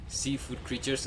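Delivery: ordinary talk, nothing marked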